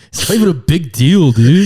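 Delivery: loud, high brush-off; mock dismissive scolding. Tybalt shrugging off Pinocchio's rejection, loud mock impatience. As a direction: wave off his heartbreak loudly.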